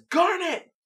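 high, loud call of her name. Retold or imitated. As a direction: call out to a friend in trouble. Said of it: "And Steven says".

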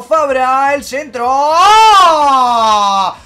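high, rising play-by-play bursting into a shout; football commentator. Acted. Streamer calling Boca's attack as commentator, excited play-by-play. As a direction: call the attack like a match commentator, erupting at the save. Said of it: "me comí otro relator por un día".

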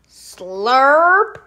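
loud wet slurp; slurping sound. Acted. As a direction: slurp the fly up. Eating the fly.

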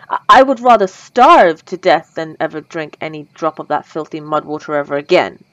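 vampire swears off all other blood, loudly; passionate outburst. Acted. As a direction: burst out with the vow. Vows never to drink other blood.

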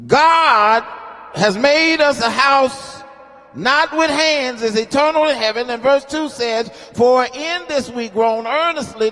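sermon cadence, rising and intense; preaching. Acted. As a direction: lift the scripture like a preacher at the pulpit. Preaching scripture at full force.

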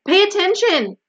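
high-pitched, loud yell; screamed order. A narrator's reading. Wilbur screams at the rat to pay attention. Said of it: "screamed Wilbur".

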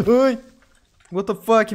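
sudden high, loud cry of shock; startled yelp. Real, not acted. Streamer shrieks when a creeper suddenly explodes. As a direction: jolt out a scream at the creeper.

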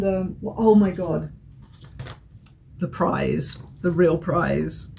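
ordinary talk, nothing marked

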